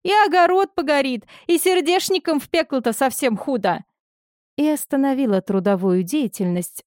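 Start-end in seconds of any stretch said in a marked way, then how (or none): 0.0-3.8 chatty village orderly. A narrator's reading.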